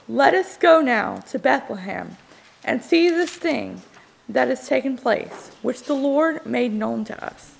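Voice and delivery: normal talking voice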